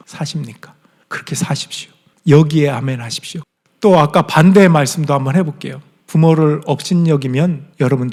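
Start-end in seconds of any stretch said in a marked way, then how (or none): 0.1-1.9 whispered challenge. Real, not acted. Challenging congregation softly.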